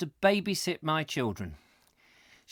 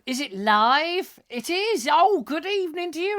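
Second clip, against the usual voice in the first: high put-on voice; 11 semitones higher, 6 dB louder than usual. Playful high greeting voice.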